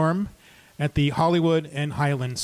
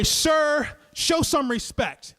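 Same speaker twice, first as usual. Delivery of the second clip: higher, slow, stern reprimand; firm rebuke; 9 semitones higher, slower than usual. Retold or imitated. Storyteller telling the ranting man off, slow and stern. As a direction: draw yourself up and demand respect.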